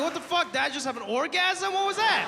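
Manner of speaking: high, startled disbelief; shocked. Retold or imitated. Son reacting to dad's sneeze, shocked and high. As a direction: react like you just heard something obscene. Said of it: "I'm like".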